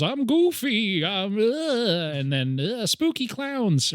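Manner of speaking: high, slow goofy monster. Retold or imitated. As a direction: ham up a silly cartoon monster. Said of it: "a C G I monster goes".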